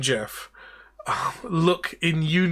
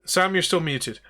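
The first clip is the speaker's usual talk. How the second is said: ordinary delivery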